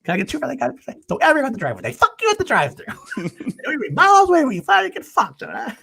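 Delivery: very high, whiny mock rant with laughs; very high hammed-up whiny rant; Leo rant. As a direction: squeal it like a panicky fast-talking sidekick. Imitating Leo's drive-through rant.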